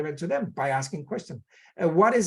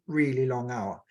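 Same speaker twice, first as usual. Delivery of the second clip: normal talking voice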